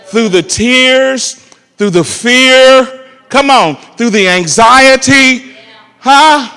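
slow, loud rising preacher cadence; climbing preacher delivery; preaching build. Acted.

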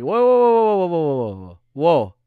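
imitating a startled guy throwing up a stop; alarmed whoa. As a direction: backpedal fast, mock panic. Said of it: "So I go like".

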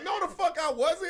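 high-pitched protest; retold denial, voice jumping way up; indignant denial. Retelling being caught online at four, indignant.

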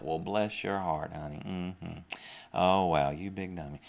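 sweetly condescending, trailing into a quiet put-down; backhanded compliment. Acted. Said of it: "almost like a backhanded".

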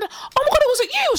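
very high, drawn-up squeal of disbelief; shocked exclamation. Retold or imitated. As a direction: act out a stunned realisation, pitch shooting up. Retells shocked realisation to woman.

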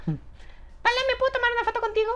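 very high, fast fan voice; excited fan. Retold or imitated. A fan asking him for a photo, high eager voice.

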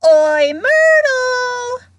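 long, high, very loud holler; bellowing. A narrator's reading. Poltergeist calling the ghost over, bellowing. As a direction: call out across the room, stretching it. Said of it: "took a deep breath and bellowed".

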